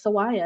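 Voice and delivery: Sawaya with a W where the R belongs. Retold or imitated. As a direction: say Sawaya, gliding through a W instead of R. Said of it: "people actually thought that her name".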